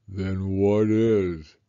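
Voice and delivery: low, very slow mournful voice; gloomy donkey. Acted. Eeyore doubts Pooh, slow and gloomy. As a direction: drag it out like a weary old donkey.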